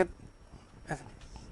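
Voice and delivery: hushed unvoiced ऐसा नहीं, drawn out; whispered aside. Real, not acted. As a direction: breathe it almost silently.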